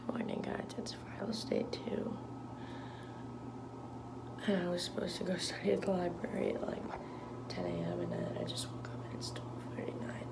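hushed whisper so as not to wake anyone; soft unvoiced whisper right after waking up; morning whisper. Real, not acted. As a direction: keep it to a breathy whisper, barely voiced. Early morning, quiet whispered update.

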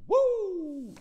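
high, loud wrestling woo yell; wrestler woo. Retold or imitated. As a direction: belt out a Ric Flair style woo. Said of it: "you'd hear somebody be like".

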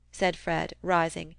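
plain reading voice

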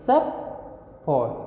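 सब फोर as two syllables with a clear pause between; split into syllables. Shown as a demonstration. Teacher shows the correct hyphen break, सब फोर said apart. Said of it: "सबसे पहले एक syllable लिखेंगे".

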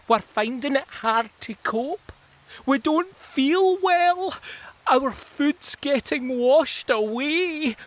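high, slow, whimpering worm; crying little worm. Acted. Worm poking its head up, crying. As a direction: plead weakly like a tiny sick worm. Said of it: "it cries".

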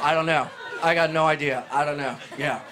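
ordinary talk, nothing marked

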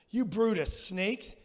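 a touch of prophetic anger; exclaimed. A narrator's reading. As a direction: read it out slowly like a rebuke. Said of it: "he exclaimed".

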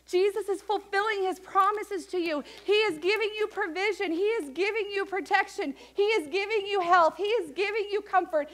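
high, loud rhythmic sermon; preaching at full force. Real, not acted. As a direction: drive home each promise.

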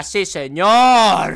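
loud, slow, stretched final word; exuberant call. Real, not acted. Gamer cheers his character on, drawn-out call. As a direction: belt it out and hold the end.

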